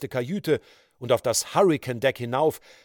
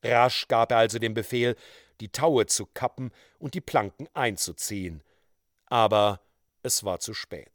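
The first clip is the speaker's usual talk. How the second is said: plain reading voice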